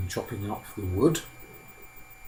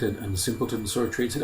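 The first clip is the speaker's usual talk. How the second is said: ordinary delivery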